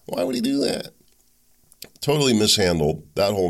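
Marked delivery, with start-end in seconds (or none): 0.0-0.9 high, feigned disbelief; mock naive voter. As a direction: play a gullible voter, wide-eyed and high. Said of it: "people conjure".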